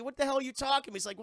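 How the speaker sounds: ordinary delivery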